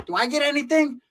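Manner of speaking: high, small mock pleading voice; lightly voiced hopeful sidekick; squeaky Dwarfwood. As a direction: ask it high like a little guy.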